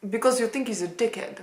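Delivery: a rough attempt at a Russian accent; a fumbling Russian accent on a blooper take. Shown as a demonstration. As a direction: try the line in a Russian accent, a bit unsure. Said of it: "How do you say dickhead in a Russian accent?".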